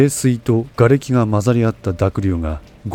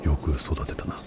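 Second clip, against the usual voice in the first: quiet veteran voice; 6 semitones lower, 11 dB quieter than usual. A narrator's reading. Veteran sniper praising an old comrade.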